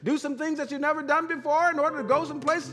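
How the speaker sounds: fast, high motivational patter; Les Brown. Retold or imitated. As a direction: rattle off the challenge in one rousing run. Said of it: "He said".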